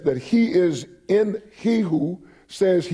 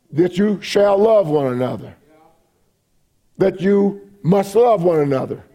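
"shall" and then "must" hit hard, higher and louder; preacher stressing "shall" and "must" after a pause; emphatic; 4 semitones higher, 4 dB louder than usual. Real, not acted. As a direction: drive home "shall love" and "must love" with rising force. Preacher escalates should to shall, must.

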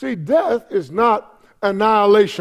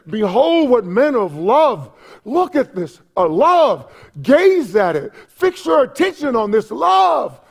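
fervent, rising exhortation; impassioned preacher's wonder; with emotion; 5 semitones higher, 4 dB louder, slower than usual. Acted. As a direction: proclaim it, building to a high cry. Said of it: "he says this with emotion".

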